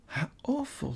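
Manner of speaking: Lucy horrified, breathless; a touch of shocked girl; aghast gasp. Acted.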